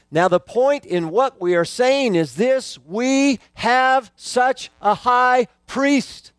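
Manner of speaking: slow, lifted reading of the verse; solemn Bible reading, drawn out; scripture reading. Acted. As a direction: read the verse out with weight. Reads scripture aloud.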